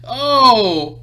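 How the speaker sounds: loud, high "Oh!" through laughter; big laughing holler; loud wincing reaction. Real, not acted. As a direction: cry out at a painful hit. Reacting to a brutal hit.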